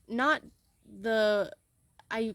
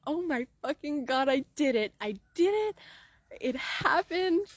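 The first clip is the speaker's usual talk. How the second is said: excited exclaim; 4 semitones higher than usual. Retold or imitated. Coach imagining reaching her goal, exclaiming in triumph. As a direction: build it up into joy.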